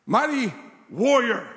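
slow, weighty angel's hail; stretched-out heroic salute; grand proclamation. Acted. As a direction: proclaim it slowly, word by word. Angel hails Gideon in retold story.

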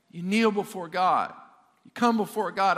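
ordinary delivery

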